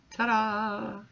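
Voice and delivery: playful ta-da for the viewers; triumphant ta-da. Real, not acted. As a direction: present the finished nails with a flourish. Reveals finished nail design.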